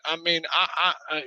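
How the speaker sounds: ordinary talk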